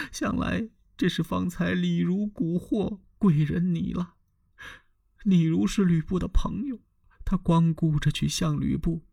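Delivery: a wronged concubine tearfully pleading with Dong Zhuo; Diaochan weeping plea. A narrator's reading. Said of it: "他一边哭着一边说". (貂蝉边哭边诉，声音高而柔)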